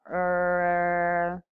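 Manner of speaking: very long, level "er"; drawn-out hesitation filler "er"; vocalized pause. Shown as a demonstration. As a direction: stall on a held "er". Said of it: "such as".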